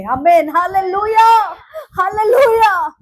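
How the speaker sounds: crying hallelujah, loud and drawn out; fervent cry of praise; shouted hallelujah. Real, not acted. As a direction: lift the congregation with a tearful shout. Preacher's climax shout.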